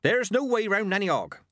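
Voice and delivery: high, firm snap; old witch standing her ground; snapped. A narrator's reading. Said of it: "she snapped".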